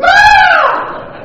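put-on high, loud shriek like a little girl; mock little-girl scream; girly scream. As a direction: shriek in fake terror. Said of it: "I screamed like a little girl".

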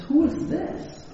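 high, slow, puzzled question; lightly acted boy wondering who's there. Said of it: "kind of scratching his head".

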